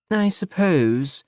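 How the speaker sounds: mother making a cutting remark slowly; disapproving mother. Acted. Mother eyeing her son's boyfriend, slow and pointed.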